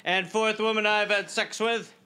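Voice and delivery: ordinary talk, nothing marked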